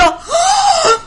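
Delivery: very high hammed-up gasp; long, high theatrical gasp; mock outraged gasp. Host reacting to the stranger's challenge, mock outraged gasp.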